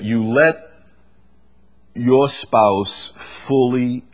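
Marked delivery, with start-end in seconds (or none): none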